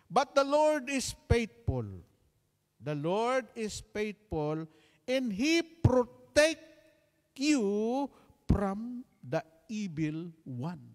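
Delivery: slow, loud, high preacher's proclamation; preaching at full force. Acted. Pastor proclaiming scripture to the congregation, slow and loud. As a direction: proclaim the verse to the whole hall.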